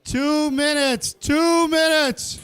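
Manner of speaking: slow, loud "Two minutes" called twice; calling out time. Real, not acted. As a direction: call the time across the kitchen.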